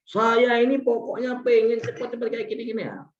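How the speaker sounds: high, insistent demand; complaining customer. Acted. Acted complaining customer cutting in. (pelanggan komplain, nada tinggi mendesak)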